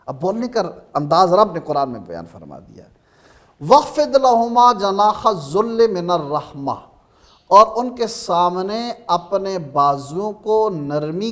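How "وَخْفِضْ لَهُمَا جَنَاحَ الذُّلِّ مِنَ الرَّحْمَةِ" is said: Quranic recitation. Shown as a demonstration. Quran verse recited in talk.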